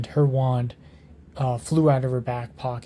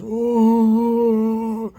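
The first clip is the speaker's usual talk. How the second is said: a mock high troll howl; troll roar; 9 semitones higher, 6 dB louder than usual. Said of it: "the troll roars".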